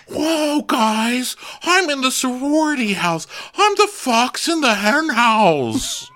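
high-pitched goofy whooping voice; silly, very high party-boy impression; Shep. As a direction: crow with hammed-up glee, stretching the words. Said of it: "And Shep's like".

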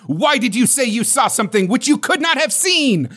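high, sharp accusation; sharp demand. Acted. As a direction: accuse him sharply, pitch up. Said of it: "demanded sharply".

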